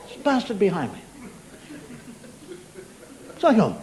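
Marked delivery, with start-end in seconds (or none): none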